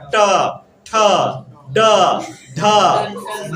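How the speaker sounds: ट, ठ, ड, ढ each said alone with pauses; teacher demo of ट ठ ड ढ; sounding out letters. Shown as a demonstration. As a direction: tap out ट ठ ड ढ one at a time. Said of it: "वहां पर जाके टकराता है".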